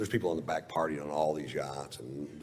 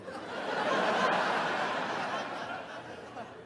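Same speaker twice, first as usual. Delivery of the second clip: silent wheezing cackle; breathless high laugh. Real, not acted. Cracks up at punchline.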